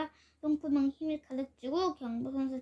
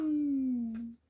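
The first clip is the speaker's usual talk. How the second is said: fart noise; much slower than usual. A narrator's reading. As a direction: blow out a big fart noise. Fart sound in the story.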